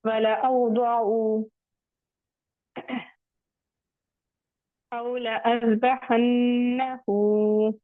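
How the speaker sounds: very slow recitation, ला in अज़बहन्नहू phrase kept short; drawn-out Quranic reading, alif after ला unsounded; silent alif after lam. Shown as a demonstration. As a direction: recite slowly, short "la" before अज़बहन्नहू, held nasal "nn". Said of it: "लाम के बाद जो अलिफ है वो नहीं पढ़ेंगे".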